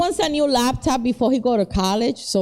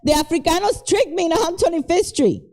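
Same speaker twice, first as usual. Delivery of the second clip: high, hammed-up shock, much higher pitch; fake surprise; 6 semitones higher than usual. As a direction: act innocent and amazed. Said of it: "I was like".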